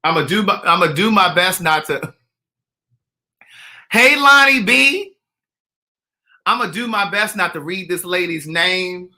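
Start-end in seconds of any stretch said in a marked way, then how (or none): 3.9-5.2 loud, drawn-out high greeting; calling out. Real, not acted. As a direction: call the name out long and high.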